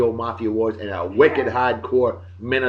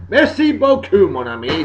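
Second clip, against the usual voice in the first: playful French; 8 semitones higher, 6 dB louder than usual. Pretend. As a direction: sing out the French thanks, starting high and loud. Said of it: "It's French, you know?".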